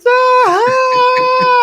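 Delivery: very high, loud scream; mock scream. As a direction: scream out in sudden horror. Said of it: "then everyone's screaming".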